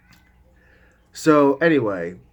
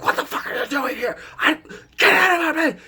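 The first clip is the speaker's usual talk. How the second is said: mock yell. Imagined intruder confrontation.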